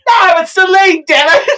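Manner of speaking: high playful outburst; comic, indignant cry; mock exasperation. Mock exasperation at wrong answer.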